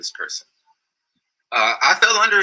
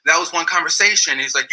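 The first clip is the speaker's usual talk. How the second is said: normal talking voice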